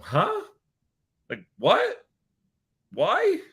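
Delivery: rising high in confusion; a bewildered, climbing "what?"; baffled. Retold or imitated. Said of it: "I just kinda go".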